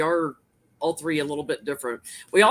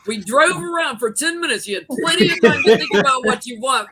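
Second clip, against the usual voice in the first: higher, louder impatient outburst; mock exasperation; 6 semitones higher, 7 dB louder than usual. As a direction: play a fed-up driver losing patience. Acted quarrel over choosing food.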